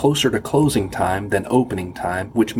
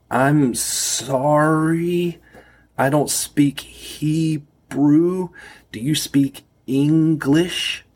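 slow, loud and higher, every word spelled out; 7 semitones higher, much slower than usual. Retold or imitated. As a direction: talk slowly and loudly to a foreigner. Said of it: "I said it very slowly and very loudly".

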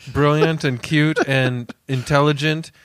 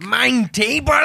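high hammed-up German accent; mock German accent; 8 semitones higher, slower than usual. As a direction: joke Hitler with a German accent. Mock Hitler calling her over.

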